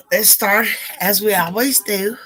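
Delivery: ordinary delivery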